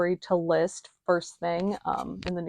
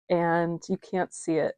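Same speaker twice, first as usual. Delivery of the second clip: ordinary talk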